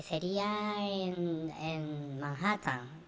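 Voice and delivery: very slow, weighing each word; slow drawn-out reply. Retold or imitated. Robert slowly revealing the Manhattan venue, drawn out. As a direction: stretch it out, pausing before Manhattan.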